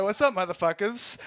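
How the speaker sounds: high, loud mock gangster swagger; tough guy. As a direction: brandish a pretend gun. Pretending to hold invisible gun.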